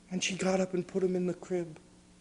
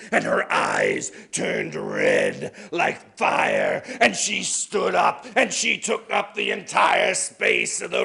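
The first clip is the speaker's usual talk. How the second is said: booming, building storyteller voice; dramatic loud narration; 2 semitones higher, 6 dB louder than usual. A narrator's reading. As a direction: swell the narration as she grows huge. Climax of the ghost story.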